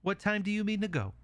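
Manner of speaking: high, small pig voice; agreeable little pig. Acted.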